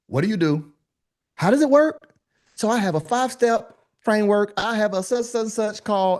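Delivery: high, mock-eager sales pitch. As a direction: parody a guru pitching her framework. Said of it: "I'm just being facetious".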